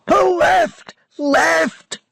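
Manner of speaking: high shouted command over the storm; urgent shout over wind. A narrator's reading. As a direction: yell the order to pull. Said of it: "William shouted".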